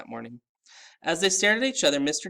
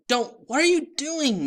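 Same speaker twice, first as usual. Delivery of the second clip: high urgent hiss; boy frantic to stop the elf; hissed alarm; 14 semitones higher, 7 dB louder than usual. A narrator's reading. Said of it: "Mister D hissed".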